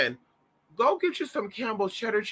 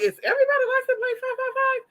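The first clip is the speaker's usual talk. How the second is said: much higher, fast, squeaky disbelief; mock incredulous squeak; faster than usual. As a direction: squeal it like you can't believe the universe. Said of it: "and I'm like".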